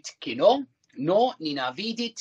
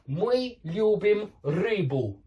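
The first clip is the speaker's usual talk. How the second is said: Мы любим рыбу said slowly and clearly; slow model sentence; 3 semitones higher, slower than usual. Shown as a demonstration. Teacher modelling the accusative slowly for the class. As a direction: lay out each word slowly for learners. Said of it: "model sentence for learners".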